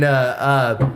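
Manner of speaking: ordinary talk, nothing marked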